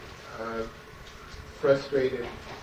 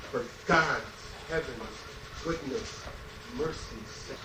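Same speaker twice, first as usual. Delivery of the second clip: softer, dying-away "For God's, heaven's, goodness, mercy's sake"; fading refrain; 9 dB quieter than usual. Acted. Poet closing the marching song, letting the refrain die out quietly. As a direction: let the refrain sink to almost nothing.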